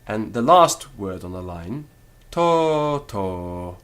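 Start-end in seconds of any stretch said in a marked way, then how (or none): 2.3-3.8 two long o vowels, first high and loud, second dropped; heavy syllables. Shown as a demonstration. Teacher showing long vowels, "to, to" both heavy. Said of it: "Each syllable is heavy".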